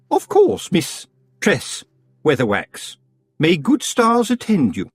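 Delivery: high, sugary-polite rival witch; sweet on top, a knife underneath; Missus Earwig. Acted. Earwig farewell.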